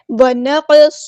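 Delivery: ونقص with a bouncing echo on the qaf; ونقص, heavy qaf released with a small rebound; qalqala on qaf. Shown as a demonstration. Teacher models qalqala for students, ونقص with bouncing qaf. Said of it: "اس طرح".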